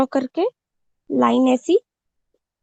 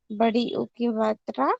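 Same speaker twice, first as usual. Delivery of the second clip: ordinary talk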